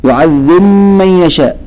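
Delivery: يعذب من يشاء with the ب dropped and the م doubled; merged ba into meem. Shown as a demonstration. Teacher demonstrates Al-Susi's minor merging. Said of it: "يدغمه فيقول".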